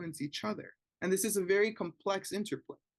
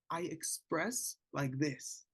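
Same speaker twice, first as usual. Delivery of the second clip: slow calm demo; 5 dB quieter, much slower than usual. Retold or imitated. Child voiced calmly expressing anger.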